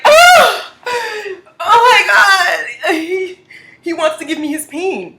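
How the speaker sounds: high laughing squeal, near tears; starstruck squeal. Retold or imitated. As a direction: squeal it, giddy and overwhelmed.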